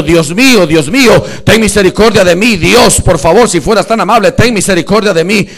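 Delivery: loud, relentless reenacted pleading; preacher shouting out a beggar's prayer; imploring God. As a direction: beg at full voice without letting up. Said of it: "le estaba diciendo".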